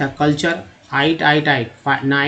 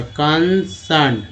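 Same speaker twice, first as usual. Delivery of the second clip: "Concern" slowly, in two separate parts; broken into parts; much slower than usual. Shown as a demonstration. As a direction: draw out "concern" with a gap in the middle. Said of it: "सी लगा तो सन हो गया". ("Concern" को धीरे, तोड़कर)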